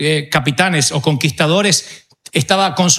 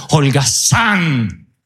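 stern master's rebuke; 4 semitones lower, much slower than usual. Acted. Dios reprende al siervo.

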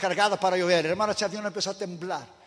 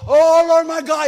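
hammed-up desperate cry, higher and louder; mock shout; 9 semitones higher, 10 dB louder, slower than usual. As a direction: cry out to God in mock terror. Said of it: "y gritó". (el pasajero grita a Dios, aterrado)

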